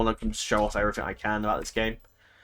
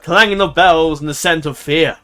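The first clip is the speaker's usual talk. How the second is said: slow, louder storyteller reading; dramatic narration; 7 dB louder than usual. Acted. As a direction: read it like an ominous movie trailer. Reads event text dramatically.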